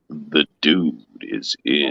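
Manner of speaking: deep, drawn-out dude impression; the dude's voice. Host showing how the dude must sound, deep slow voice. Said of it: "his voice sounds like this when he says".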